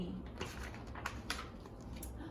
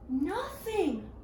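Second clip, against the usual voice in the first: ordinary delivery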